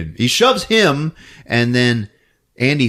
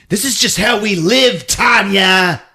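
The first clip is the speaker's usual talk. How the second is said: high, loud, hammed-up rant; exasperated mock shout, drawn out at the end; mock attacker yell; 8 semitones higher, 5 dB louder, slower than usual. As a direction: yell it like a melodramatic villain. Acted attacker yelling at victim.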